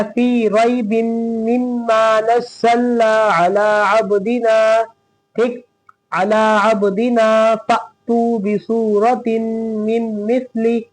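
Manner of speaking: slow, drawn-out chanted recitation; Quran recitation. Shown as a demonstration. Teacher reciting for students, slow and melodic. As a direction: recite slowly, stretching each vowel.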